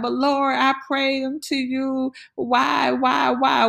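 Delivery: high complaining "why" to God; whiny plea. Retold or imitated. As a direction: whine to the Lord. Acted whining to Lord.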